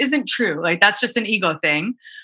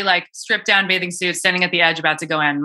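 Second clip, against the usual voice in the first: ordinary talk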